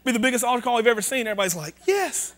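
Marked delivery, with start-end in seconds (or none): 1.9-2.3 mock eager yes. Crowd's imagined answer to free money.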